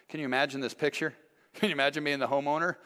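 ordinary talk

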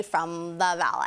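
put-on Valley-girl drawl on the last word; exaggerated Valley-girl accent. Shown as a demonstration. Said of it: "inspired by the Valley".

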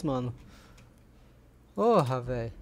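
ordinary delivery

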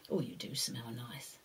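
soft whispered aside; whispering. Real, not acted. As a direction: whisper it close and low. Aside to child.

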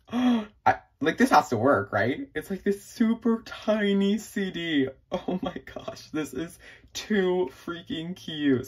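can't contain the delight; excited gush. Real, not acted. Thrilled by a tiny CD.

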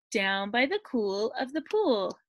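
ordinary delivery, nothing marked

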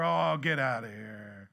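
low, slow brush-off; a lightly voiced husband shrugging her off; dismissive husband. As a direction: wave her off lazily. Husband dismissing wife, imitated.

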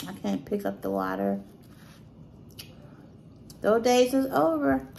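talking while chewing; chewing between words; mouth full of bread. Real, not acted. Seen 2: hand brings bread piece to mouth during first three seconds.

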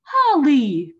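loud, high call of a name; crying out. Acted. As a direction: call out her name in surprise. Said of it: "cried her mother".

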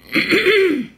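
loud throat clear. Real, not acted. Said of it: "Excuse me".

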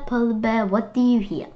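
usual speaking voice

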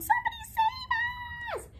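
very high, hammed-up panicked shriek; storybook squeal of terror. A narrator's reading. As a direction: scream for rescue in a piercing high voice. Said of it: "shrieked the dogs".

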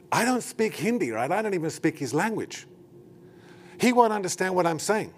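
flustered protest. Retold or imitated. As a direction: protest as if the task is impossible. Retold flustered protest to the sister.

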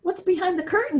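high, loud eager question; a curious kid peeking behind a curtain; curious boy. A narrator's reading. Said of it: "said Nick".

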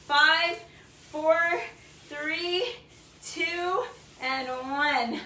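loud, effortful rep counting; straining with effort. Real, not acted. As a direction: push the count out through the burn. Straining through hard workout reps.

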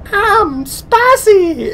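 very high-pitched, loud, silly voice; goofy voice. Retold or imitated. Roommate replying to the jalapeno talk, absurdly high goofy voice. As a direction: squeal it like a goofy commercial character. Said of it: "That's the goofiest voice that I've ever heard".

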